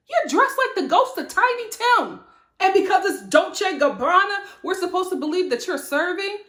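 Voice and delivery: high-pitched, indignant roast; indignant mockery. Speaker roasting Sutton for criticizing others' fashion, indignant. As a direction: read her outfit to filth, voice up high. Mock scolding of Sutton's outfit.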